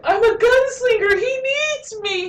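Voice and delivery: very high, choked-up wonder; overjoyed kid. Retold or imitated. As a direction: imitate a child thrilled to be needed. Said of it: "little kid's face lights up".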